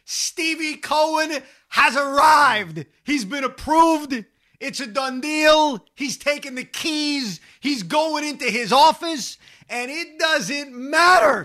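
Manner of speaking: high, voice breaking with joy; emotional. Real, not acted. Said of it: "I'm getting emotional".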